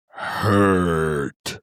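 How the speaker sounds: slow, drawn-out dim brute; lightly voiced slow-witted monster; simple brute creature.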